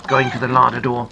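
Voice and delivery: usual speaking voice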